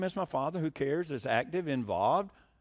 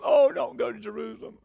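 begging plea; 5 semitones higher, 4 dB louder than usual. Retold or imitated. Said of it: "they're begging him".

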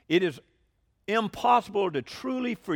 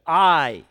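the single word I hit hard and much louder; emphatic I; 2 semitones lower, 12 dB louder than usual. Shown as a demonstration. Preacher stressing Jesus's own word to the congregation, hammering the I. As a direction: slam the word I like God himself is speaking. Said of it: "and this is very, very emphatic".

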